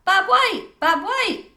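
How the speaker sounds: very high, loud bird-call shout; group shout. A narrator's reading. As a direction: shout the bird's name like a cheer. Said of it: "get in a group and shout".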